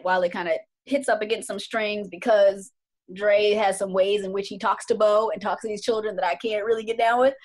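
ordinary talk

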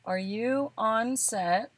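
very slow, drawn-out words; word by word, thinking out a text; hesitant, stretched-out drafting; composing aloud. Real, not acted.

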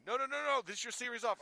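high, loud, firm no; insistent loud coach. Retold or imitated. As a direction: shut him down loudly. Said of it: "Cougs comes to me".

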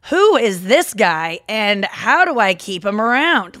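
loud, slow, swooping mom impression; hammed-up, drawn-out disbelief in mom's voice; Julie Glazer. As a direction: do an exaggerated mom character, drawing out the words. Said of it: "that was very Julie Glazer".

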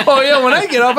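ordinary delivery

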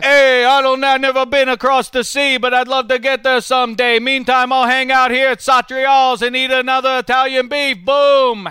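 loud, high, hammed-up TV host voice; Chris Wesley impression. London podcast crew hears an NFL host impression, loud and hammed up. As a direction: boom it out like a brash NFL show host. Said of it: "I'm Chris Wesley".

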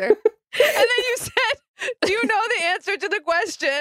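retelling a friend's line through real laughter; can barely get the words out for laughing; laughing hard. Real, not acted. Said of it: "Okay, I'm really crying".

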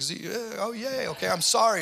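breezy high chirpy "I'm sorry" with a lifted end; flippant apology. Retold or imitated. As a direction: toss off the sorry like a reset button. Mocking flippant quick apology.

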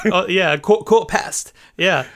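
put-on a light, playful stab at a British accent on "quarter past"; mock British accent. American guest showing the hosts his British accent, light mock British accent. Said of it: "That was my British accent".

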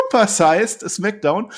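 slow booming announcer call; ring announcer. Pretend. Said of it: "ich darf es wieder machen. Achtung".

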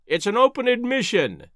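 high-pitched exclamation of derision; crying out. A narrator's reading. Professor scoffing at the blank sheet, crying out. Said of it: "he cried".

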